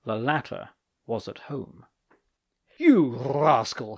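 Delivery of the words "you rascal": angry. Acted. Angry man confronts slanderer.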